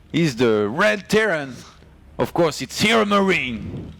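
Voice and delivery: slow, high mock announcer intro; Funka intro. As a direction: draw out the player introduction in imitation. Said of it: "I'm gonna do a Funka intro".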